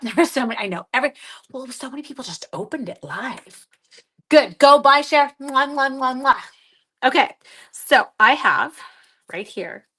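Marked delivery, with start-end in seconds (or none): 4.3-5.3 bright loud shout to viewers; loud excited cheer. Real, not acted. 5.4-6.4 talking between kisses. Real, not acted. Blowing kisses to chat.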